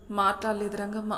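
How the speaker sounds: ordinary delivery, nothing marked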